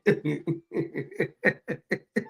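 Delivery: chuckling run of laughter; amused laugh at own joke. Real, not acted. Laughing after the oyster parody, amused.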